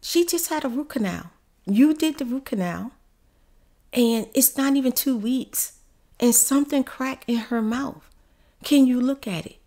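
a hint of Nadine asking for help; retelling her friend's plea; Nadine quoted.

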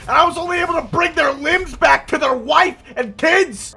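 hammed-up old soldier, loud and high; grizzled war veteran. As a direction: shout a melodramatic war story. Mock war veteran speech.

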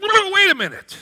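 mock sudden realization; snapping out of it. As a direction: catch yourself sharply. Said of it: "you snap out".

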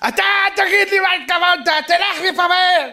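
high, loud mock-furious order; angry shouting. Angry man orders the speaker to leave, hammed-up shouting. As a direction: yell it as if throwing someone out.

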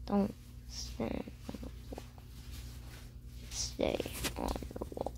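low eerie whisper; creepy whisper. Acted. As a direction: breathe it out like a ghost. Creepy whisper echoing game.

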